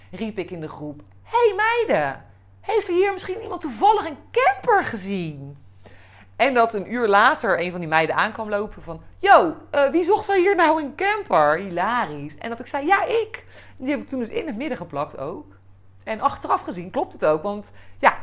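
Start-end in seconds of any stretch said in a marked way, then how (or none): 1.2-5.6 calling out. Retold or imitated. Asking the group of girls about a camper, high calling voice. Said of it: "ik hoor het me nog zo roepen". 9.2-11.6 excited call. Retold or imitated. Girl calls out about camper. 12.9-13.4 eager yes. Retold or imitated. Eager reply to camper question.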